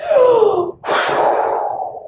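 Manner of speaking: sharp rising intake of breath; high startled gasp. A narrator's reading. Storyteller gasping as the storm ends. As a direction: gasp as the wind dies. Wind stops suddenly.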